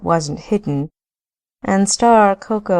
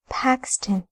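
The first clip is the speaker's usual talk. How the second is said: whispered; 6 semitones higher, 5 dB quieter than usual. Acted. Name dropped to whisper.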